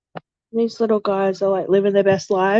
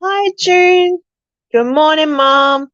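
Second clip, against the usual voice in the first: higher, louder sing-song greeting; 6 semitones higher, 5 dB louder, slower than usual. Real, not acted. Owner greeting her cat June good morning, higher and louder. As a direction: chirp a bright hello to the cat.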